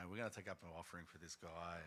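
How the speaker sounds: flat, grudging announcement; reluctant pastor announcing; unenthusiastic. Retold or imitated. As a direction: say it like you don't want to. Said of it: "I'm not enthusiastic".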